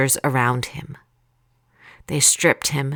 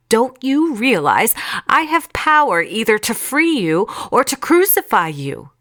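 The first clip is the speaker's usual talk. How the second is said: high, forceful challenge; raised, affronted questioning; indignant; 7 semitones higher, 4 dB louder than usual. A narrator's reading. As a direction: assert your power over a silent prisoner. Pilate threatens the silent Jesus.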